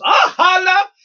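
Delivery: high, loud sign-off holler; sudden whooping outburst; imitated yell. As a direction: belt it out like an on-air shout. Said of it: "he yells".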